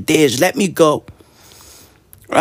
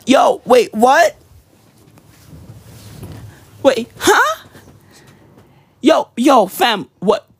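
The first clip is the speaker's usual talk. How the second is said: high rising double take; mock-shocked, pitch shooting up; baffled disbelief; 6 semitones higher, slower than usual. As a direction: act stunned, voice climbing on each question. Acts baffled at the scam.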